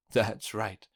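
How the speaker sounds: plain reading voice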